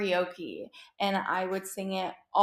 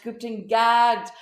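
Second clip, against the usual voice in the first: gooped and gagged stretched out, louder; loud slow repeat; 8 dB louder, slower than usual. Real, not acted. Vlogger to viewers, loud slow gush. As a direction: drag out gooped and gagged for emphasis. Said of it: "repeated louder slower".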